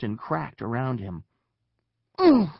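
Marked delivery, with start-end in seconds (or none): 2.2-2.5 short, loud, high moan; pained grunt. A narrator's reading. Eric hitting the ground, loud pained moan. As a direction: grunt on impact. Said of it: "Eric moaned".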